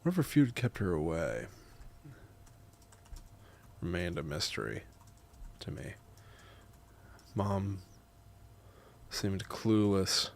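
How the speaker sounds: measured, soft reading with long pauses; memoir narration. A narrator's reading. Writer narrating grandma's visit, measured memoir reading. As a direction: read it quietly, phrase by phrase. Reads own memoir aloud in takes.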